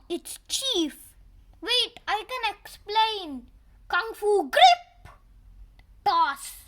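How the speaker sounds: usual speaking voice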